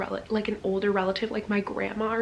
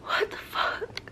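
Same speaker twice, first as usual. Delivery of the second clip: stunned whisper; slower than usual. Real, not acted. Stunned by the chapter.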